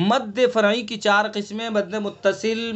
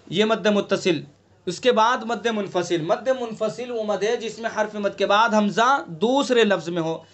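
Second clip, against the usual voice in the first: ordinary talk, nothing marked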